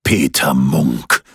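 low, slow, booming giant's voice; deep booming forest spirit. A narrator's reading. Forest spirit confronts Peter, deep and booming. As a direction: loom over him with a deep rumbling voice. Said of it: "mit tiefer und dröhnender Stimme".

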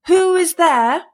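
high, loud, drawn-out challenge; crying out. A narrator's reading. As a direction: call out slowly to a stranger, high. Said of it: "cried he".

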